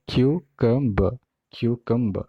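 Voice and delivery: cucumber drawn out, KYOO-kum-buh; correct cucumber pronunciation. Shown as a demonstration. Teacher drilling learners, slow KYOO-kum-buh for cucumber. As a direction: stretch cucumber syllable by syllable. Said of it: "कुकुम्बर कहीं नहीं है".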